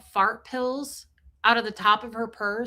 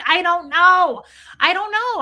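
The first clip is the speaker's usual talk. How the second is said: louder, high-pitched retold exasperation; exasperated outburst; 7 semitones higher, 9 dB louder than usual. Daughter answering mom's question about bloating, exasperated. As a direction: throw it back loudly, at your wits' end. Acted exasperated reply to mom.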